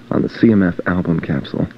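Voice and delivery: ordinary talk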